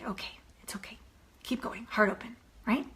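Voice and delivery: hushed, soothing self-talk; calming whisper. Shown as a demonstration. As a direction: whisper yourself calm. Self-soothing under hate.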